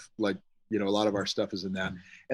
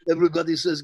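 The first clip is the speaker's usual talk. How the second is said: ordinary talk, nothing marked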